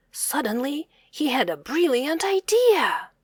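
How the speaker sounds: plain reading voice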